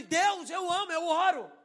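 high, loud plea to God; retold loud protest. Pastor retells protesting to God, loud indignant plea. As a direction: protest loudly, as if pleading your case.

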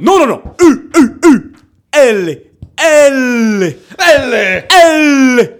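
high, loud, emphatic correcting and repeating; shouted alphabet drill. Retold or imitated. Imitating teacher and class reciting Italian letters, shouting. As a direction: bellow each letter like a whole class drilling. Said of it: "on criait ça toute la classe".

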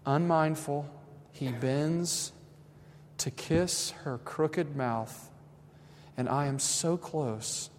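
soft, slow reverent reading; story reading. Acted. Pastor winding down by reading a moving story, soft and slow. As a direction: read it hushed, like a holy moment.

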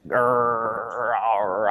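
low zombie growl. Shown as a demonstration. Interviewer asking an actress about zombie noises, low growl. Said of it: "would you be like".

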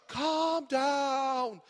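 lightly imitated, drawn-out advert line; a hint of mock placating; advert catchphrase. Preacher repeats the insurer's catchphrase, mock-soothing. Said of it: "You know that motor insurer".

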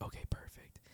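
breathy hushed whisper; barely voiced, keep it secret. Sneaking into the friends' room, whispered.

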